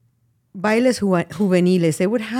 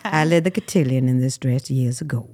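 put-on Southern accent; 5 semitones lower than usual. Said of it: "I do the Southern accent".

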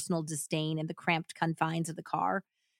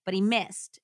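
usual speaking voice

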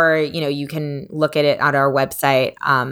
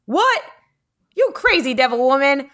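high, mock-outraged old man; grandpa denying it; 7 semitones higher than usual. Granddaughter mimics grandpa's high, mock-outraged denial. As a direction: play the indignant grandpa in front of family. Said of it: "my grandpa on the low has told me".

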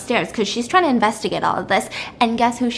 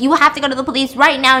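fast, loud, higher acted demand; urgent insistence; 4 semitones higher, 6 dB louder, faster than usual. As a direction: press urgently, rushing the words out. Acted wife insists on police.

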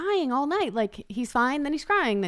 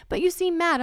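put-on higher, small worried child voice; voicing worried son; 7 semitones higher than usual. Mom voicing son's worried remark, higher. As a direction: say it timidly like a little boy. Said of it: "And he's like".

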